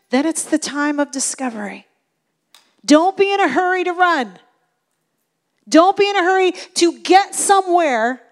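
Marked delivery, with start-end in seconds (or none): 2.8-8.2 urgent preaching. Real, not acted. Preacher urging the congregation.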